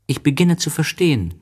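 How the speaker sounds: put-on high childlike story voice; voiced little prince, lifted well up. A narrator's reading. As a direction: pitch it high for a small boy.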